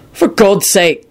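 impatient snap. A narrator's reading. Quentin cutting off friend's self-doubt, impatient. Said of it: "Quentin snapped impatiently".

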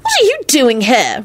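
cross, high-pitched question; angry squeal. A narrator's reading. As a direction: confront an unwanted arrival. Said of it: "Rosie squealed angrily".

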